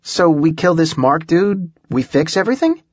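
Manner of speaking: eager, high-pitched question; excited. A narrator's reading. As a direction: ask it eagerly, voice climbing. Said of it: "Holly was excited".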